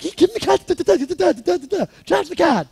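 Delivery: very high, shouted frantic order; hammed-up squealing impatience; frantic urging. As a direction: yell it in a comic panic. Acts out frantic order to pay.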